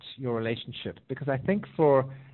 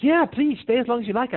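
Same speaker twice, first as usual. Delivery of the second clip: high, louder burst of acted eagerness; eager pleading; 10 semitones higher, 5 dB louder than usual. Person urging the little bird to stay, eager high-pitched pleading. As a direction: gush an invitation, acting it out.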